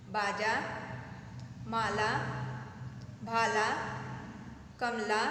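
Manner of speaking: slow list of words with long pauses; reading a word list. Shown as a demonstration. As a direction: read each word clearly for children. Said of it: "ये कुछ शब्द हैं".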